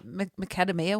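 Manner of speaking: "macadamia" said "muh-KAD-uh-mee-uh"; hesitate, then stress "KAD" in "macadamia"; "macadamia" mispronounced after a stammered "m"; mum's odd pronunciation. Retold or imitated. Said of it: "She said".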